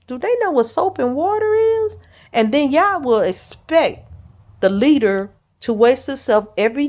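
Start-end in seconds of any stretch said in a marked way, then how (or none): 0.0-2.0 high, incredulous cry to the Lord; a mock-shocked prayer pitched way up; disgusted disbelief, voice leaping high; exasperated inner thought. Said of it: "you be wondering like".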